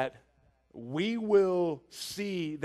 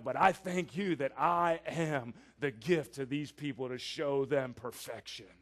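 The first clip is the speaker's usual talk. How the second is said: proud Pharisee prayer; 7 semitones lower than usual. Acted. A preacher acts out the Pharisee thanking God, smug. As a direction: pray as if you're God's gift to humanity. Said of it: "that's his attitude".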